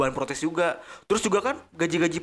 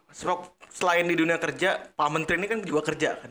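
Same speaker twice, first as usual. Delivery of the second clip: ordinary delivery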